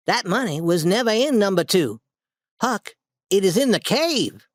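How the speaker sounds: a hint of an excited boy letting out a secret; Tom's voice. A narrator's reading.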